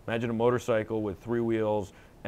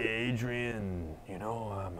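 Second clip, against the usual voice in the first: Dirty Harry crossed with Stallone; 7 dB quieter, much slower than usual. Retold or imitated. Rocky accent mumbled at Adrian, softer. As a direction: mumble it like Stallone doing Clint. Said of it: "that's my Dirty Harry".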